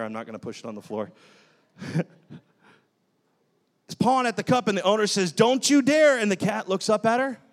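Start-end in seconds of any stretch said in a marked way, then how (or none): 5.3-6.2 higher, louder warning to the cat; stern warning. Acted. Owner to cat pawing at a cup, stern warning. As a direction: warn it off, voice raised.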